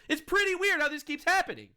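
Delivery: high-pitched sarcastic wonder; mock puzzlement. Sarcastic conclusion after listing repeats.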